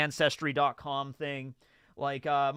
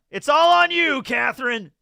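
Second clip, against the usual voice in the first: calling out; 10 semitones higher, 12 dB louder than usual. Real, not acted. Calling out to Catherine.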